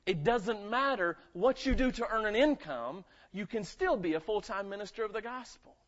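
preached loud. Shown as a demonstration. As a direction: build it up and drive it home. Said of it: "I don't care if you're a janitor".

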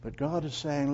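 normal talking voice

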